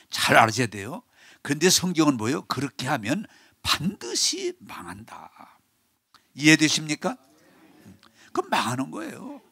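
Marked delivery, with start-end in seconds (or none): 2.4-5.6 hushed warning. Acted. Preacher whispering scripture's warning to congregation. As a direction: whisper it, slow and grave.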